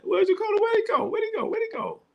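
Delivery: squeaky high mock panic, searching; a high, fretting cry of where'd he go. Streamer hunting a vanished viewer comment, high and fretful.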